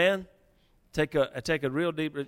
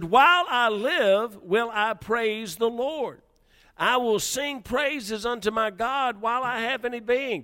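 higher, slow and emphatic; excited praise; 6 semitones higher than usual. Shown as a demonstration. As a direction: proclaim it with rising joy. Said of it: "He's excited".

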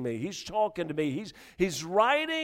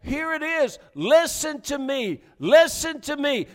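loud, higher, slow calling out; wisdom crying out; 7 semitones higher, 5 dB louder than usual. Acted. Wisdom calling the simple in the streets, loud cry. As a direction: cry out to the crowd to listen. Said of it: "wisdom cries out and said".

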